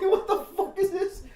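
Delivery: high, loud, laughing outburst; laughing disbelief. Retold or imitated. Girl seeing her opponent doing stretches, laughing disbelief. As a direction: squeal it through laughter in disbelief.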